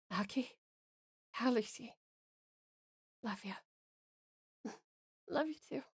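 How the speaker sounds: very soft, fading murmur; faint, tender, with long pauses; dying goodbye. Acted. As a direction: breathe out the last loving words. Two lovers' dying goodbyes.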